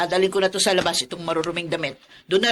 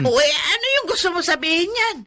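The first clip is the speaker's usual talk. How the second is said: angry retort; 10 semitones higher than usual. Acted. Mother-in-law quarrel with Tony.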